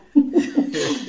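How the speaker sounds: real, spontaneous laughter; amused laugh. Real, not acted. Sister amused by the brother's vision, laughing. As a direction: break into a laugh after the question.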